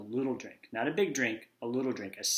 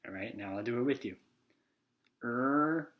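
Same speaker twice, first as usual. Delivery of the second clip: ordinary delivery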